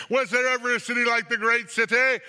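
high, loud cry of dismay; loud dramatic lamenting question. A narrator's reading. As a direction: call it out in anguish over a ruined city. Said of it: "will cry out as they see the smoke".

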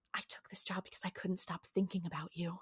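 seductive whisper. Retold or imitated. Bella seducing Sam in his dream, in a whisper. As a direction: lean in close and whisper the confession.

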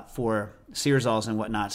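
ordinary delivery

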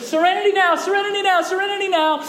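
high, frantic mock yelling; Kramer. Neighbour tries out the calming phrase, high comic yell. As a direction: yell it three times over at a high pitch. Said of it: "then Kramer tries this out".

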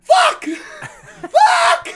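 high, loud laughing curse; panicked shout. Retold or imitated. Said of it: "'cause I just went".

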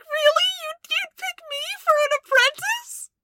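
high, loud, teary voice; tearful disbelief. Acted. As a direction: near tears at the offer. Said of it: "[name] just starts crying".

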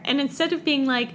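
normal talking voice

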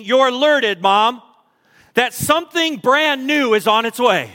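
rising, impassioned preacher's build; preaching. Acted. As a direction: preach it with growing fervour to the mothers.

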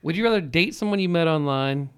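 ordinary talk

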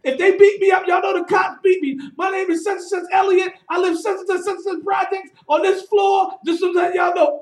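high loud appeal to witnesses; loud public shout. Retold or imitated. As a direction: shout to the whole boat. Shaheed yelling to boat passengers.

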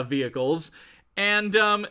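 ordinary delivery

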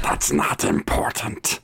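low raspy whisper; menacing witch. Acted. Witch dodges the question, raspy menacing voice. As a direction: brush it off in a hoarse low croak.

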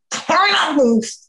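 high, very slow "Pineapples"; code word. Retold or imitated. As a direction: drag out "Pineapples" as a warning. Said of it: "I'm like".

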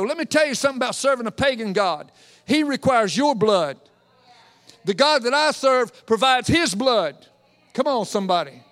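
ordinary delivery

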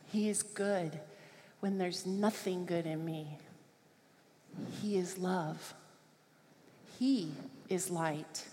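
slow, hushed worship cadence, each line left to hang; devotional recitation. Acted. Preacher quietly reciting God's attributes to the congregation, slow and hushed. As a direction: lay each name of God down softly and slowly.

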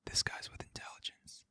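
secretive whisper into an ear. Pretend. Manager whispering to the cashier, hushed whisper. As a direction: breathe it, no voice at all. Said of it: "he whispers in the, the cashier's ear".

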